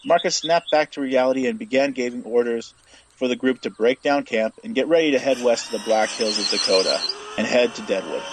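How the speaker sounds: plain reading voice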